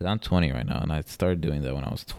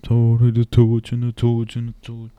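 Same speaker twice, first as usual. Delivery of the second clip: loud high chant of torch; mock YouTuber intro chant; Tobuscus intro imitation; 4 semitones higher, 8 dB louder, slower than usual. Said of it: "he's like Torch".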